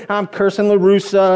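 ordinary talk

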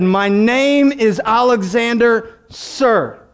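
drawn-out soldier's reply to his commander; deliberate, weighty confession; slow solemn answer. Acted. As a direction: stretch the name, then drop on sir. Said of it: "He said".

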